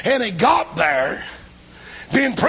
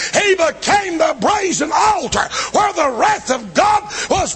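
full-force preaching; 9 semitones higher than usual. Acted. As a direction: preach it at the top of your voice to the congregation. Sermon climax on Calvary.

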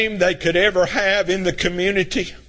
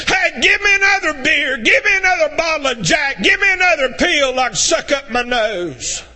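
high, urgent shouted demands; demanding addict; 5 semitones higher than usual. Acted. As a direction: call out greedily for the next fix. Acted craving addict demanding more.

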